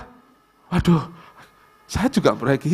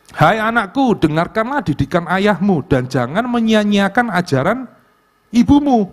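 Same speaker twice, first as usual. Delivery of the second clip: raised, solemn Bible reading voice; scripture reading; 7 semitones higher than usual. Acted. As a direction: proclaim the verse higher than the talk. Reads the Bible verse aloud.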